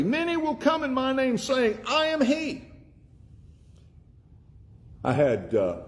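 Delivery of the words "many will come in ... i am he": much higher, straining warning cry; rising warning. Acted. Preacher voicing Jesus warning of false messiahs, high and straining.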